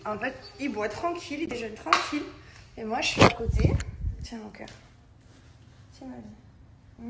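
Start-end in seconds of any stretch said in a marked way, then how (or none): 4.2-7.1 tender murmur. Real, not acted. Mother feeding her baby tenderly.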